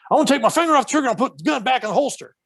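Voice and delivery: put-on high, fast North Carolina cop accent; Southern accent, nervous cop with a gun drawn. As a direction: rattle it off as a jittery Southern cop. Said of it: "That's why I'm doing that Southern accent".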